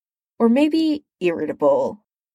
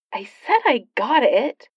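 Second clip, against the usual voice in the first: indignant; 5 semitones higher than usual. A narrator's reading. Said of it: "said the male voice indignantly".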